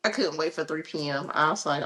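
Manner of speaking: ordinary delivery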